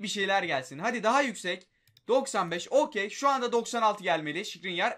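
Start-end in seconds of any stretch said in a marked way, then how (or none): none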